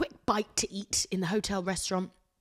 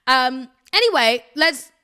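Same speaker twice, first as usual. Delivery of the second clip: much louder and higher, bursting back on track; loud reset; 6 semitones higher, 10 dB louder than usual. Real, not acted. To listeners, realising she skipped the intro, loudly. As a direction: snap the show into gear.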